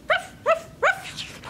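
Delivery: high yappy little-dog ruff; dog barking. A narrator's reading. Narrator voicing the dog that wrecks the castle, yappy. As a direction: bark like a small puppy.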